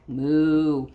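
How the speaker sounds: low, drawn-out moo; cow moo. Acted. Teacher voicing the typing cows, low moo.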